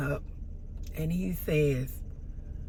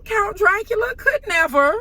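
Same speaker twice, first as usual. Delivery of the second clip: higher and louder sassy retort; emphatic comeback; 7 dB louder than usual. Retold or imitated. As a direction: fire the comeback right at him. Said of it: "so I said, you know".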